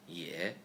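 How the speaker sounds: ye dipping low then rising, third tone. Shown as a demonstration. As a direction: drop ye low, curl it up.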